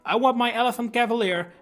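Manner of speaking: ordinary delivery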